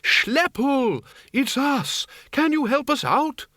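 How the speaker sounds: very high-pitched calling out; high, urgent holler; shouting for help. A narrator's reading. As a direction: call to someone down a passage, pitched high. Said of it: "shouted Windle".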